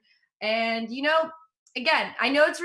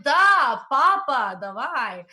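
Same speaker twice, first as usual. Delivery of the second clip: a hint of a gruff Russian accent; mock Russian lover, lightly accented; 5 dB louder, much slower than usual. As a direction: bark the "Da" a bit louder, Russian style. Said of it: "And in Russian, it's like".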